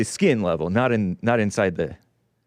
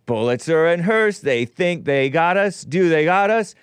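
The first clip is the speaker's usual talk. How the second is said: louder, higher rally cry building to a yelled No; rallying shout; 7 semitones higher, 3 dB louder than usual. Retold or imitated. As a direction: rouse the strikers, end on a big shout. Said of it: "because they're like".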